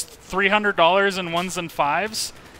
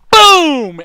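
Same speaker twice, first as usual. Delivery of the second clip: a sudden shouted boom, high and loud; 10 semitones higher, 14 dB louder than usual. Retold or imitated. Retelling the grandma's smack, a sudden loud boom. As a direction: slam the word out like the hit.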